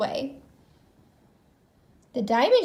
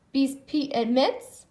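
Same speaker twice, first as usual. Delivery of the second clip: ordinary delivery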